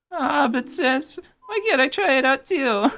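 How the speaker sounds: high, pleading little brother; whiny little fairy. Acted. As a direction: whine to big sister.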